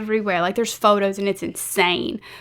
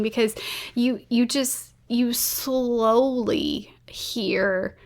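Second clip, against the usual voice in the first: getting emotional; 2 semitones higher, much slower than usual. Real, not acted. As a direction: voice dropping to a slow, halting hush. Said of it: "I'm getting emotional".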